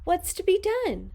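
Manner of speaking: high, quick fretful question; worried teacher. Acted. Miss Nelson asking the janitor for help, high and quick. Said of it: "said Miss Nelson".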